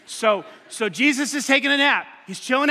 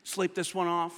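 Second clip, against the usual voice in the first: sleepy, unbothered; 4 semitones lower, 7 dB quieter, slower than usual. Retold or imitated.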